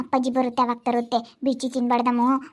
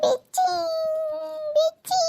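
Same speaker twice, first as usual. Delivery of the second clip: crying plea; 12 semitones higher, 4 dB quieter, slower than usual. Real, not acted. Crying toddler begs her family for a biscuit. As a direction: sob out the word for biscuit.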